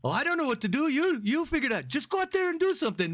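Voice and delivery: put-on high-pitched, childish squabble; silly high voices passing the buck; mock bickering guards. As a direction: imitate flustered guards in a high, comic voice. Retold squabble of border guards.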